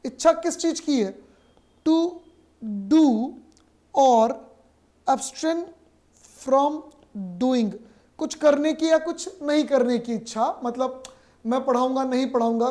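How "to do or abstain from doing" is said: each word said apart with long pauses. Shown as a demonstration. Teacher dictating the definition to students, one word at a time. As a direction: spell it out slowly, one word at a time, for the class. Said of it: "teacher spells out phrase word by word".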